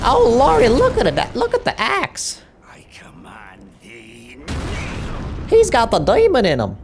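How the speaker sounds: put-on excited, very high Southern yokel accent. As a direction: holler like a startled country preacher. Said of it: "Speak in an American Southern accent".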